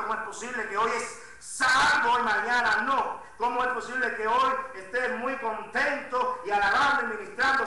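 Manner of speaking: loud, driving sermon delivery, words stretched for weight; full-force preaching. Acted. Pastor urging his congregation, loud full-force preaching. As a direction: press the congregation hard from the pulpit.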